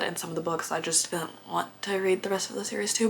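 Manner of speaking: soft whisper, as if letting them in on it; whispered aside. Real, not acted. Vlogger confiding to viewers in a whisper. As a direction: drop to a secret whisper.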